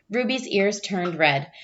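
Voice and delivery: plain reading voice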